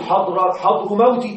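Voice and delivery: حضر موت as "hadru mawti", two inflected words; wrong genitive construct. Shown as a demonstration. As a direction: put case endings on both halves of حضر موت: "hadru mawti". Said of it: "لا نقول".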